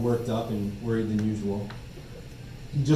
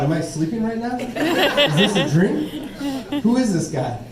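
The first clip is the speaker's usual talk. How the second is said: surprised disbelief; 9 semitones higher, 3 dB louder, slower than usual. Retold or imitated. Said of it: "I thought".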